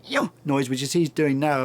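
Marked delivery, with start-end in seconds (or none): 0.0-0.3 short high imitated yelp; rough human copy of monkey chirp; woolly monkey call. Shown as a demonstration. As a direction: try a monkey's little call. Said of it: "can't get the pitch in my voice".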